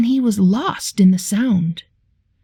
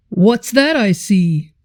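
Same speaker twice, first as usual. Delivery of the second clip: bus driver voice. Acted. Bus driver greets them.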